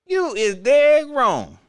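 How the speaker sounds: loud, slow you dead wrong; mock scolding. Host scolds the commentator, loud and slow. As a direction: call him out, loud and drawn out.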